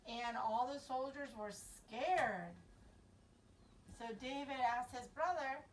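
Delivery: usual speaking voice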